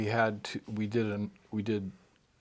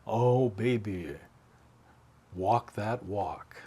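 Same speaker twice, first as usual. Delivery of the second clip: 1 requested line; 3 dB louder, much slower than usual. Retold or imitated. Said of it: "Just say".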